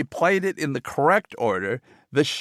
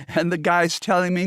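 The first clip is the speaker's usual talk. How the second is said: ordinary talk, nothing marked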